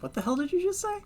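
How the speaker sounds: high, soft mock-bewildered voice; baffled coworker. Coworker overhearing muttered Japanese at work, mock bafflement. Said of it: "and somebody's like".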